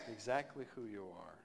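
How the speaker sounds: ordinary talk, nothing marked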